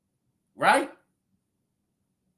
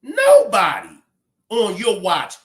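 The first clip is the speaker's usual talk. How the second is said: indignant outburst; 2 semitones higher, 5 dB louder than usual. Real, not acted. Berating a man for not protecting.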